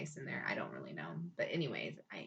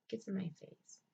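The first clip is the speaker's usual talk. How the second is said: normal talking voice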